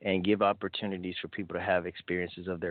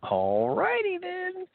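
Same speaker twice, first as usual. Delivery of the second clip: goofy catchphrase voice; 4 dB louder, slower than usual. Pretend. Goofy catchphrase in show banter.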